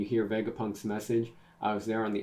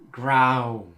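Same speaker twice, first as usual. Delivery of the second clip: growl sound; 3 semitones higher, 10 dB louder than usual. Acted. Reading a growl sound effect.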